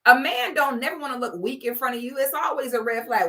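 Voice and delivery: ordinary talk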